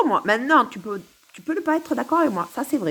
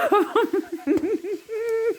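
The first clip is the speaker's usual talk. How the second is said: high-pitched giggling fit; high, drawn-out laugh; laughter; 10 semitones higher than usual. Real, not acted. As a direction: giggle helplessly at your own bit. Laughs at her own routine.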